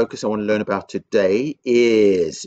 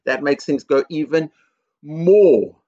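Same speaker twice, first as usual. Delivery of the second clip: more rises high and loud; stress on more; 5 semitones higher, 5 dB louder, slower than usual. Real, not acted. Teacher stresses escalation.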